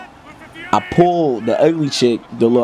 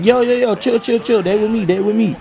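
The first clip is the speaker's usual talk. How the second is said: high, urgent call to calm things down; high-pitched voice of a friend calling "chill"; 10 semitones higher than usual. Retold or imitated. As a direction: call out to stop the fight. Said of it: "it's like".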